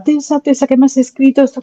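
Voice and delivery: high, tearful cry of a moved reader. Retold or imitated. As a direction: retell the reader's choked-up plea, pitched high. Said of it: "y ponerse a llorar".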